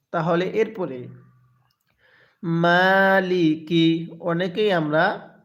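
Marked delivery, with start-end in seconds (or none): 2.4-4.1 very slow মালিকি with a long drawn "maa"; correct elongation. Shown as a demonstration. Teacher models the reading for learners, slow elongated মালিকি. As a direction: stretch the first vowel of মালিকি, level and measured. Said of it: "তাহলে কিভাবে পড়ব?".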